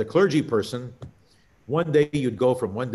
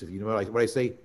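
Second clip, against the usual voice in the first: ordinary talk, nothing marked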